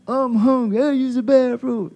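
put-on high-pitched pestering kid; put-on high child's voice; complaining child. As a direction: imitate a child nagging from the back seat.

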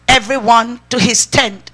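normal talking voice

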